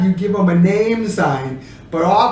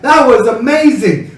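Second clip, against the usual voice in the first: louder, bright "That was amazing"; positive amazement; 4 semitones higher, 6 dB louder than usual. Shown as a demonstration. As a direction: sound thrilled and impressed. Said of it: "is it more positive?".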